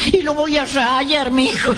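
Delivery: put-on high, very slow old lady's voice; a lightly voiced poor old lady, drawn out; high and slow, laughing at the end; old woman.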